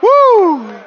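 high, drawn-out preacher's whoop; excited whoop. Real, not acted. Preacher carried away by his sermon, exultant whoop.

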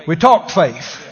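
preaching shout. Acted. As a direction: shout it out to the church. Preacher rallying congregation.

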